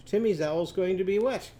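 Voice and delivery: usual speaking voice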